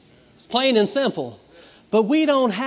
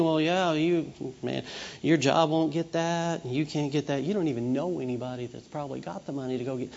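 low, soft sneering murmur, sinking quieter; discouraging inner voice; 7 semitones lower, 8 dB quieter than usual. Retold or imitated. The enemy telling the believer he can't, low insidious murmur. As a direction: slip doubt in like a tempter's voice.

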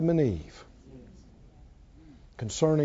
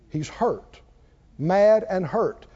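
normal talking voice

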